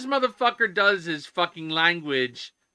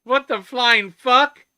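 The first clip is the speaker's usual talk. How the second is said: drawn-out, louder outraged swear; outraged exclamation; 6 semitones higher, 6 dB louder, slower than usual. Real, not acted. Streamer outraged at a review rule, slow loud disbelief. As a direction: spit it out slowly in disbelief.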